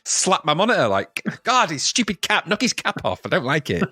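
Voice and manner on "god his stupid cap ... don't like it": mock angry rant. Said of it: "It made me angry".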